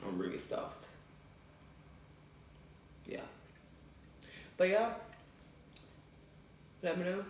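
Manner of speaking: normal talking voice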